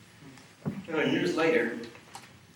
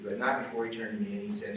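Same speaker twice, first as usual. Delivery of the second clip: usual speaking voice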